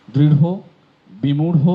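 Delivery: দৃঢ় and বিমূঢ় each ending in a breathy rh; h added to ঢ়. Shown as a demonstration. Teacher drilling the aspirated flap. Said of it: "হয়কার হয়ে যাচ্ছে সব".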